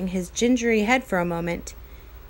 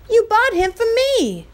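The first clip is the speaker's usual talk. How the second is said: much higher, louder protest; indignant shout; 12 semitones higher, 6 dB louder than usual. Acted. Omri arguing with Patrick over the cowboy, shouting. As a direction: fling the accusation back.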